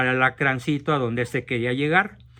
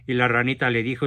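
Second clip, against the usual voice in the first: ordinary delivery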